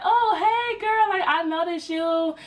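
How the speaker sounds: high mock-sweet sister voice; sister's sugary greeting. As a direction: greet her sweetly like you just spotted her. Voicing the sister's fake greeting.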